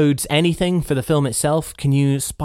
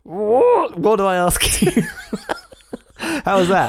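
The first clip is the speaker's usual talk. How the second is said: laughing; 7 semitones higher, slower than usual. Real, not acted. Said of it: "I didn't know".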